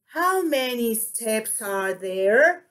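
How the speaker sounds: slow dip from "How" up to "there"; deliberate model question, rising on "there"; question intonation. Shown as a demonstration. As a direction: finish with "there" swinging upward. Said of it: "That is the intonation".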